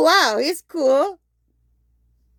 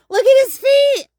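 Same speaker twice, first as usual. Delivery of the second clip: higher and louder delighted cry; excited exclaim; 9 semitones higher, 5 dB louder than usual. Real, not acted. Showing viewers the frog's feet, thrilled. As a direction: squeal it out.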